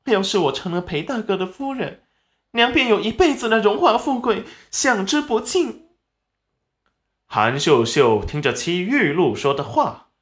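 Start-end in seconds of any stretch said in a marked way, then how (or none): 0.0-5.9 high-pitched mock crying plea; acted sobbing daughter, pitched way up; whimpering, tearful. A narrator's reading. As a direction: whimper pitifully to win your mother over. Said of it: "立即嘤嘤地哭了起来".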